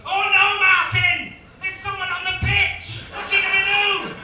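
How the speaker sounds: very loud, high-pitched, drawn-out pundit panic; alarmed sports commentator. Acted. Commentator crying to Martin about a pitch invader, frantic shouting. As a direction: shout it like live disaster commentary. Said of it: "the commentator will kick in and go".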